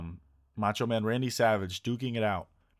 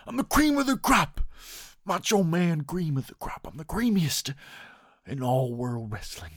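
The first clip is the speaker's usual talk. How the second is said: Macho Man impression; 5 semitones higher, slower than usual. Quoting Randy Savage's YouTube interview in his voice. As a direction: brag like a wrestling legend. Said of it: "He has an interview on YouTube".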